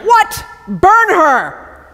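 high, yelled outburst, hammed-up; mock outrage. Judah hearing Tamar is pregnant, a mock yell. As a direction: jump up to a shocked shout.

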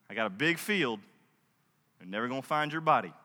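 low, quiet menace, played for laughs; mock threat. Boaz warning young men off Ruth, mock threat. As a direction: drop low and soft for a veiled threat.

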